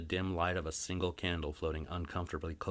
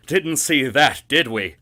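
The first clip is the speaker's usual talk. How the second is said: much louder, higher booming gloat; gloating big brute; 8 semitones higher, 14 dB louder than usual. Acted. Grimbly gloating to his investor, booming and loud. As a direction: boom it out, pleased with himself.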